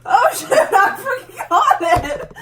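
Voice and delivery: giggling as she quotes mom; laughing mimic. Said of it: "And she goes".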